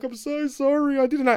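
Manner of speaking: high-pitched imitation of tearful apology; mock crying. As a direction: wail it as a retold sobbing plea. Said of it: "I'm crying".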